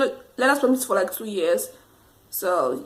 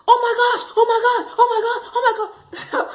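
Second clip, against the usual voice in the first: much higher, frantic, half laughing oh my God; panicked screaming; 12 semitones higher than usual. Retold or imitated. Imitating the examiner screaming at her driving, panicked and high. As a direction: squeal it like a terrified examiner. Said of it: "The examiner started screaming".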